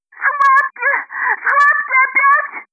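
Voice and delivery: very high-pitched squeal of pain; shrieking woman in labour; screeching. Acted. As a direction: squeal it out in agony. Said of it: "перешла на визг, успев крикнуть".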